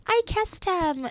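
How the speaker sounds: high, soft, drawn-out mimicry; mock boasting contestant. Mimicking Jess after the first kiss, mock boast.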